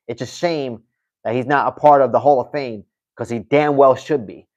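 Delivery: ordinary delivery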